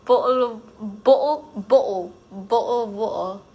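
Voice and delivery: exaggerated British accent, T's swallowed; hammed-up British, starting loud. Shown as a demonstration. Streamer repeating a viewer's requested phrase, hammed-up British accent. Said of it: "say bottle of water in British accent".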